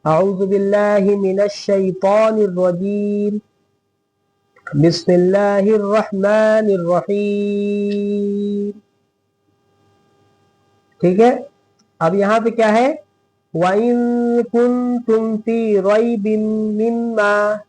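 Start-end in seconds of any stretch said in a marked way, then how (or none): 0.0-8.7 slow, drawn-out chanted recitation; Quran recitation. Shown as a demonstration. Teacher reciting for students, slow and melodic. 13.5-17.6 slow, drawn-out chanted recitation; Quran recitation. Shown as a demonstration. Teacher reciting for students, slow and melodic.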